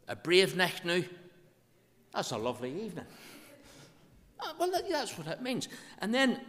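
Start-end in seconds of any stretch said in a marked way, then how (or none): 0.1-1.0 raised, louder Ulster Scots repeat; broad Ulster Scots. Shown as a demonstration. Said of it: "speak Ulster Scotch".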